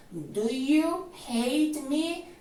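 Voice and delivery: "Do you hate me?" lento, subiendo al final; entonación de pregunta. Shown as a demonstration. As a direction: pregunta despacio con la voz hacia arriba. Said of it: "Importantísimo que le pongas la entonación de pregunta". (entonación de pregunta marcada)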